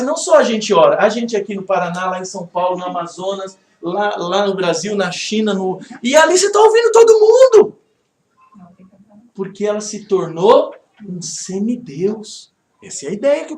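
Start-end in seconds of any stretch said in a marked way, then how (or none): 6.0-7.8 much higher, loud exclamation; exclamação enfática. Real, not acted. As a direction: burst out loud and high at the absurdity. Exclama o absurdo da ideia. (exclamação bem alta e aguda)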